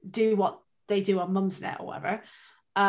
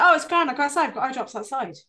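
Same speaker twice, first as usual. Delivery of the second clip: breezy reassurance; 5 semitones higher, 6 dB louder than usual. Retold or imitated. Retold reply to husband about eye drops.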